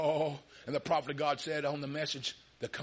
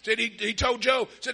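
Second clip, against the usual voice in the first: ordinary delivery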